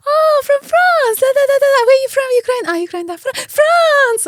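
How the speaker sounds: over-the-top delighted stranger, hammed up; gushing stranger. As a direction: mimic someone thrilled by France and deflated by Ukraine.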